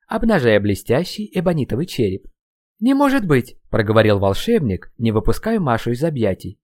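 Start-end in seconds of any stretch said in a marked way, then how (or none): 2.8-3.6 high, loud shocked exclamation; shocked cry. Acted. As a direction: gasp it out in disbelief. Shock at the skull.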